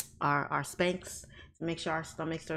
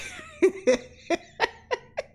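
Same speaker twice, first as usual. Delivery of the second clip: loud laugh going up high; high shrieking laugh; 4 dB louder than usual. Real, not acted. Amused by the chat, a high squeal of laughter.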